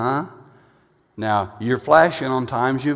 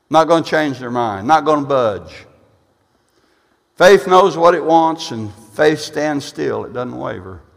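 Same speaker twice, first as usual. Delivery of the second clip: ordinary talk, nothing marked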